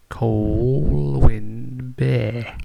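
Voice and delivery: Colwyn Bay drawn out very slow, each syllable laid down; patient demo pace on Colwyn Bay; slow careful spelling-out. Shown as a demonstration. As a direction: sound out Colwyn Bay slowly for a learner. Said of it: "How about an even easier one?".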